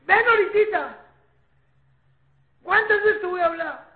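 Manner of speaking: high, loud parental scolding; loud, high-pitched telling-off. Shown as a demonstration. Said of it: "si subiste o bajaste".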